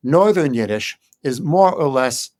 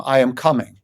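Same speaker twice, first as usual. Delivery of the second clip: normal talking voice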